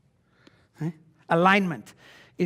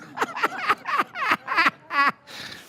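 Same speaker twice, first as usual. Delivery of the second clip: high burst of laughter. Real, not acted. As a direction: laugh hard at the punchline. Laughs at own joke.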